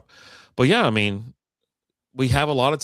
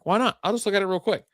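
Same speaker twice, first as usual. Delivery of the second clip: high-pitched, casual self-persuasion; light, high inner-voice quote; talking himself into it; 9 semitones higher, faster than usual. Retold or imitated. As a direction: lift the voice into an airy why-not.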